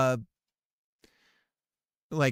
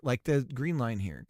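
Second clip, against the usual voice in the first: usual speaking voice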